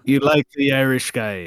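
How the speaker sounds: put-on a light Irish accent. Co-host teasing his partner in a light Irish accent. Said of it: "that was a good accent".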